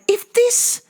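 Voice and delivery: high-pitched mock fury; high squeaky rant. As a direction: squeak your accusation at the ex. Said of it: "And I was like".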